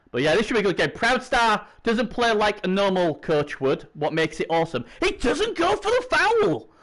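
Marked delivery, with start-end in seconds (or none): 5.0-6.7 high, drawn-out cry of surprise; stunned high exclamation, stretched out; real astonishment. Real, not acted. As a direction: react in amazement as the play unfolds. Streamer startled by a play.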